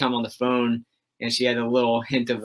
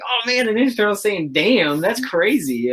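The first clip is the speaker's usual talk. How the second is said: put-on higher, a kid's amazed disbelief; 8 semitones higher than usual. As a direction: replay your young self, stunned by a cuss word. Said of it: "I was like".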